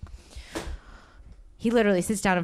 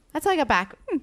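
usual speaking voice

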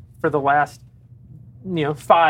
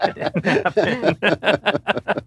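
laughing; 5 semitones higher, much slower than usual. Acted. Repeated through laughter.

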